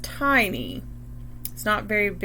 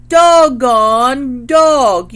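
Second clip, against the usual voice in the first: very loud, slow squawk; parrot squawk; 12 dB louder, much slower than usual. A narrator's reading. Parrot mimics the mom, very loud slow squawk. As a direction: belt it out like a parrot. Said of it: "And the parrot says".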